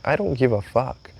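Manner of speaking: dismissive fake friend. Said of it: "they're like".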